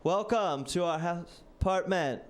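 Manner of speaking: high, slow, overly formal greeting; stiff nervous host. Retold or imitated. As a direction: play an awkward robot hostess welcoming a guest. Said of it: "and she was like".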